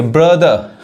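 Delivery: ब्रदर ending "-duh", no final r; silent r. Shown as a demonstration. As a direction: drop the last r of ब्रदर. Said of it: "आर नहीं पढ़ाता है".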